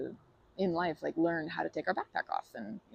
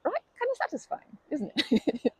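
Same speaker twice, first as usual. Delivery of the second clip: playful tease; 10 semitones higher, 5 dB louder than usual. Real, not acted. Teasing after the throw.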